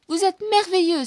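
high, loud delighted exclamation; delighted cry. A narrator's reading. Bianca cries out to her hero, high and loud. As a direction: cry out in admiration. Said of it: "s'écrie Bianca".

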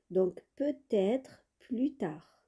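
peut-être plus tard, very slow, with the T of peut-être linked onto être; slow teacher demo of the liaison in peut-être. Shown as a demonstration. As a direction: carry the T of peut-être across, slowly. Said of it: "on fait la liaison aussi".

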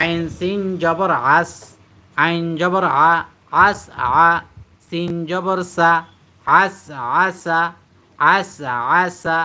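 slow spelling drill, throat pressed on every "আ"; pressed ayn. Shown as a demonstration. Teacher models the word letter by letter, pressed throaty "আ". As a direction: sound out "আস আসা" slowly with pressed ayn and sharp s. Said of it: "ডাবি উচ্চারণ করতে হয়".

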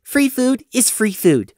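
"Free food is free food" said slowly as a final word; solemn finality. Acted. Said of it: "with solemn finality".

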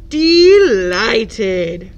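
slow, loud booming voice; booming elephant. Acted. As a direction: boom it out like a big elephant. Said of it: "boomed Elephant".

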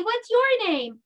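"What's your name?" called out high and loud; yelling. Shown as a demonstration. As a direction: shout the question to the kids. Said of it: "then you yell out your name".

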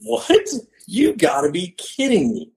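high-pitched incredulous reaction; retold disbelief. Hunter doubts the camper's bear sighting, mock disbelief. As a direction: start high, imitating your own astonishment.

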